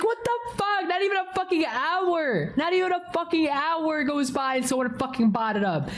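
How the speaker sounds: high-pitched mock outrage; retold upset. Streamer retells losing the books within an hour, upset. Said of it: "Dude, I was so fucking upset".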